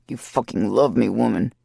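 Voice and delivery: hammed-up low roar at her; mild bellow. A narrator's reading. Lover bellowing at the woman, lightly voiced low. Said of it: "he bellows".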